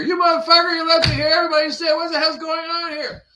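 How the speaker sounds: a tiny abandoned microbe shouting up at him; stranded microbe yelling at the gardener, high and loud; angry leftover microbe. Acted. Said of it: "that one percent sends me a finger".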